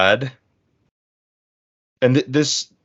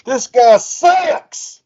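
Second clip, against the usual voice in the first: booing crowd; 8 semitones higher, 4 dB louder, much slower than usual. Pretend. Mock crowd booing the player.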